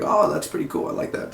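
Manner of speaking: soft breathy aside; whispered approval. Retold or imitated. Fan admiring the shaved head, whispered. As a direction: whisper the compliment. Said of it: "I was like".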